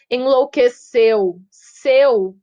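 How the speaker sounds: slow "Enlouqueceu" with a loud final "seu"; stress on final syllable. Shown as a demonstration. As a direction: land hard on "seu" at the end of "Enlouqueceu". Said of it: "É a sílaba forte".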